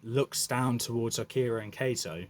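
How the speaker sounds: usual speaking voice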